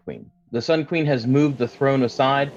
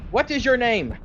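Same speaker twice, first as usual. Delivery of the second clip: panicked guards; 12 semitones higher than usual. Acted. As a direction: shout frantically, holding the prisoner down. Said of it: "They're just like".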